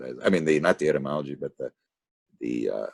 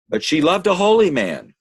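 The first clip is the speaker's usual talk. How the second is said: high, loud protest; impassioned defense; 14 semitones higher, 6 dB louder than usual. Acted. Elijah defending Salome to the doubting visitor, high and loud. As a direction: fling it out in her defence.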